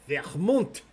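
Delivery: VERMONT with a French throaty R; French pronunciation. Shown as a demonstration. Quiz host revealing the correct answer, French pronunciation of "Vermont". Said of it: "Am I saying it well?".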